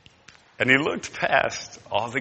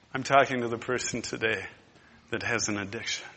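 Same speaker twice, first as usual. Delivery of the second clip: ordinary talk, nothing marked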